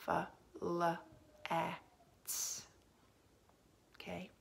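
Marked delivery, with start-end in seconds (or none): 0.1-2.6 "f, l, a, t" as four separate sounds with pauses; sounding out. Shown as a demonstration. Teacher helping pupils spell flat, sounding out each letter. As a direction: segment each sound, softer on the final "t". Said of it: "Say it, and then do the".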